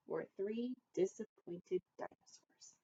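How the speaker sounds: a soft, breathy whisper; whispered dinosaurs. A narrator's reading. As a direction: let the word fade into a whisper. Three disappointed dinosaurs, whispered.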